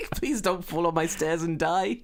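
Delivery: laughing slowly through it; laughing plea. Real, not acted. Begging the neighbour not to fall, laughing. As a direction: plead while still laughing.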